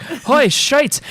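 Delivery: startled Irish guy swearing; hammed-up Irish shriek; mock Irish exclamation. Imitating Irish Banshee.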